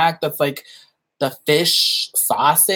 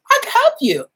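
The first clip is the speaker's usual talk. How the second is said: flirty offer; 3 dB louder than usual. Retold or imitated. Flirting line retold.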